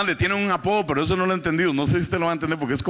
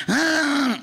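motor roar; 10 semitones higher than usual. Retold or imitated. Comedian imitating street motorcycle racing, high engine whine.

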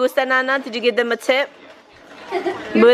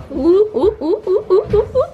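rising, higher ooh after ooh; delighted cooing; 9 semitones higher, 3 dB louder than usual. Real, not acted. Mom admiring the sparkly painted nails, delighted cooing. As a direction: coo louder and higher with delight.

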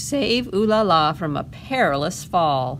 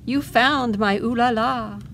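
grateful joy; 6 semitones higher, 4 dB louder than usual. Acted. Poodle mother thanking the pup.

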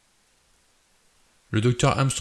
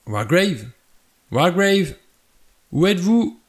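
calling for someone, rising higher and louder; searching call, more insistent each time; 8 semitones higher, 5 dB louder than usual. A narrator's reading. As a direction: call through the house for a missing man. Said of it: "appela Armstrong".

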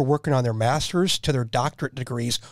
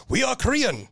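a bad, higher Korean accent impression; mock Korean accent; 9 semitones higher than usual. Teller mimicking the colonel, bad mock Korean accent. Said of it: "that was bad".

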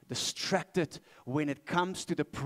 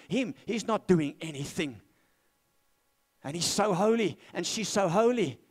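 higher, louder indignant sister's complaint; mock complaining Martha; 4 semitones higher, 5 dB louder than usual. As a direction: act out a put-upon sister griping about the work. Preacher acts out Martha's complaint.

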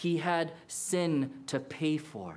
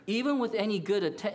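ordinary delivery

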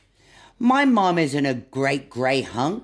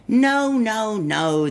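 upset little monkey protest; 4 semitones higher, slower than usual. Acted. Monkey protests wrong animal.

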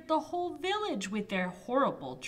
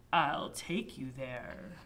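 low wolf voice; 7 semitones lower than usual. Acted. Wolf answers.

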